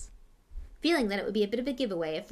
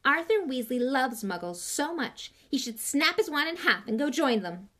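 higher, louder sneer; scornful; 5 semitones higher, 5 dB louder than usual. A narrator's reading. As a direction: pour scorn on him. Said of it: "said Malfoy scornfully".

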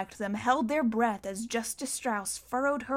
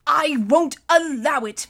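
high, loud, slow objection; gasped protest; 4 semitones higher, 7 dB louder than usual. A narrator's reading. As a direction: force the objection out after a coughing fit. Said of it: "Mister Poe finally gasped".

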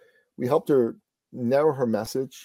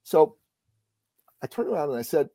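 ordinary delivery

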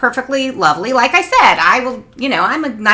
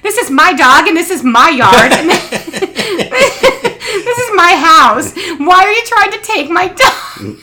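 high, laughing mock accusation; accusing lady; 9 semitones higher, 5 dB louder, slower than usual. Owner accusing the mother of stealing her dog, half-laughing. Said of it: "She goes".

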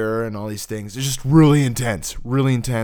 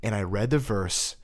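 ordinary talk

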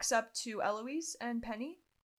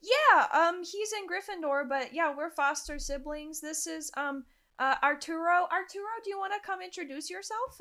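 higher-pitched, chatty in-character voice; upbeat girl student character; 6 semitones higher, 4 dB louder than usual. Acted. Templa calls her foster brother over to introduce himself, upbeat and high. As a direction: lift the voice high for an eager introducer.

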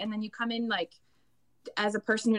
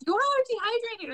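high-pitched, incredulous scolding of the class; mock exasperated teacher; 13 semitones higher than usual. Choir teacher telling tired singers, pitched up and incredulous. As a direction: pretend teacher, pitched way up in disbelief. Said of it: "And then I'm like".

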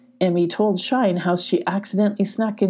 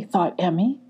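ordinary delivery, nothing marked

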